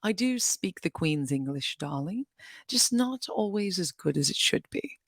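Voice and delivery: careful put-on Queen's English; British accent, trying harder this time; better British accent. Shown as a demonstration. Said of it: "let me do it a little better".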